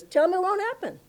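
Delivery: high-pitched, insistent retort; emphatic dismissal. Retold or imitated. Guru sends word to the astrologer, emphatic and high. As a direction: pitch it right up and wave it away. Retold guru defies a prediction.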